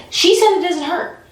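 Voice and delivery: high-pitched, incredulous protest; retold outrage, pitch jumping up; indignant complaint. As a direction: object as if you've been lied to. Retold indignation at being lied to.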